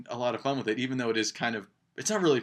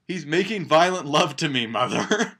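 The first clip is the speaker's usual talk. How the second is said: loud, higher shout up the stairs; yelling up; 5 semitones higher, 8 dB louder than usual. Retold or imitated. Mary shouts back up to her nagging mother, loud and higher. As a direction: fling it up to her defiantly. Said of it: "she yells up".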